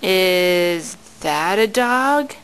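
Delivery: drawn-out, low question; slow and low. Shown as a demonstration. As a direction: stretch each word out slowly. Parent asks baby about picture.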